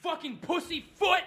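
high-pitched, indignant tirade; furious rant. Real, not acted. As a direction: rant in real outrage about the threat. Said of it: "I am fucking furious".

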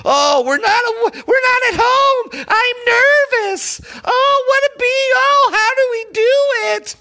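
high, whiny mock panic, shouted; mocking rival fans. Fan mocking rival team's fans, high whiny mockery. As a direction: sneer at them in a high, nervous, whining voice. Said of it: "Because these guys".

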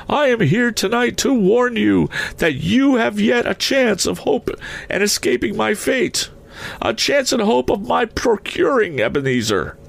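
very high, fervent spectral voice; solemn warning ghost. A narrator's reading. Ghost come tonight to warn Scrooge, solemn high voice. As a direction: warn him high, as a spirit offering escape.

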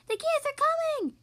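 high, loud excited shout. A narrator's reading. Pup spotting geese, shouting high and loud. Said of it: "he shouted".

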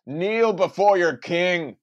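booming slow king voice; slow regal command. Pretend. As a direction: command them to kneel like a king. Mock royal command to friend.

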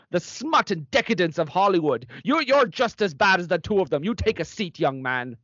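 put-on a stern Mother Superior scolding her boys; stern nun. Nun scolding the podcast boys, stern and indignant. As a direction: scold them like a strict old nun.

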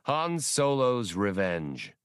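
ordinary delivery

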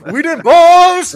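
loud, high, drawn-out hype man yell. Acted. Interrupting the health teacher's sex talk, hype man yell. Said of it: "hype man for like, a, a sex talk".

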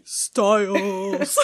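Derek impression. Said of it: "my Derek impression".

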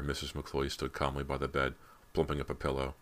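usual speaking voice

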